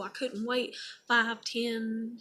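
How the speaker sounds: usual speaking voice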